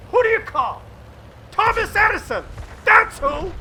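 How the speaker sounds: high, loud rallying call and answer; hype announcer call. Real, not acted. Commentator hyping his hero player, announcer shout. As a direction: pump up the crowd like a jingle.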